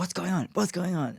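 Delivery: high, urgent worried mum voice. Retold or imitated. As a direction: ask twice, alarmed.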